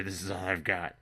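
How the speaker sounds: ordinary talk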